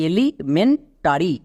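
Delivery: Elementary broken as এলি, মেন, tarry; teacher sounding out elementary in chunks; slow part-by-part pronunciation. Shown as a demonstration. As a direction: say elementary slowly with gaps between the parts. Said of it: "পুরো ওয়ার্ডের উচ্চারণ কি দাঁড়ালো".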